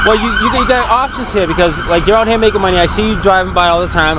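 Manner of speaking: retold argument, voice climbing in exasperation; heated retelling. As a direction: push back at him with rising indignation. Retold lecture to the man.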